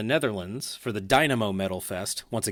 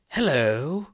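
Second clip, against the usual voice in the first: British hello; 3 semitones higher than usual. Pretend. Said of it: "you'd say".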